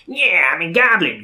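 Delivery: high loud squeaky goblin. Retold or imitated. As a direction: typical cartoon goblin voice.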